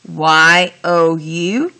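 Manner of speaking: "Y O U" said letter by letter, slow; spelling out slowly. Shown as a demonstration. Teacher spells the word for students, slowly and clearly. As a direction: spell out "Y O U" deliberately. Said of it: "So here's how you do it".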